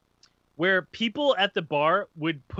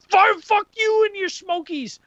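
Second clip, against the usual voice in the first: imitated enraged yell, very high and drawn out; mock a guy boiling over, slow and high; heated; 14 semitones higher, 4 dB louder, slower than usual. Stranger cursing the man and his Smokies, heated. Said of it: "getting heated".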